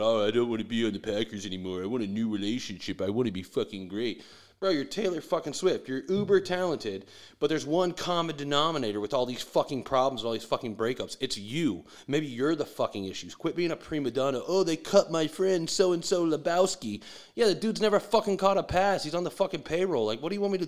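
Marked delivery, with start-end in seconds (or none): 0.0-4.2 a lightly put-on, whining Rodgers voice; whiny mock quote. Mocking Rodgers before the draft, a hint of whining. 14.5-17.0 slow, higher, louder whining mimicry; mock whine. Mocking Rodgers complaining about his cut friend, slow whine.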